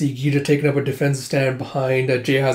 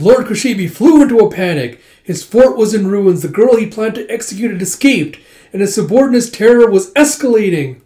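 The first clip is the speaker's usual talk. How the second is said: higher, louder dramatic storyteller; puppet show narrator; 7 semitones higher, 7 dB louder than usual. Acted. Puppeteer telling the townsfolk the battle, dramatic announcing. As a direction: announce it to a street crowd.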